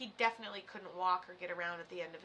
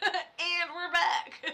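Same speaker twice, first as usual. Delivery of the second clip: slow, laughing it out; 7 semitones higher, 5 dB louder, much slower than usual. Real, not acted. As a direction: giggle through it. Laughing after a tangent.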